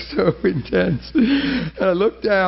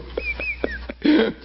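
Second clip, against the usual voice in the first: high giggle; 3 dB quieter than usual. Real, not acted. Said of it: "I'm a mess".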